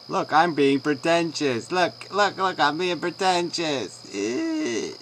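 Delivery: put-on high, loud childish show-off voice; mock five-year-old. Mocking the other speaker as a five-year-old playing, high childish taunt. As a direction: whine it like an attention-seeking little kid, mockingly. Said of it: "little five-year-olds running around".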